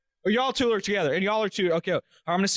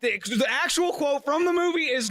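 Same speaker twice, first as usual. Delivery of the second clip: normal talking voice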